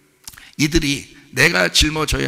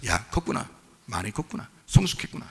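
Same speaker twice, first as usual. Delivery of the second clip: quiet amazement; 5 semitones lower, 7 dB quieter than usual. Retold or imitated. Retelling his own thought, softened.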